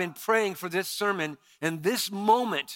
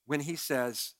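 usual speaking voice

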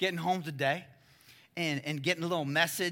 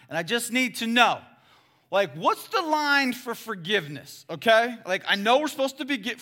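louder, higher, eager questioning; eager Peter; 4 semitones higher, 8 dB louder than usual. Acted. Peter pressing Jesus about forgiveness, eager and loud. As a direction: press Jesus for a number, keyed up.

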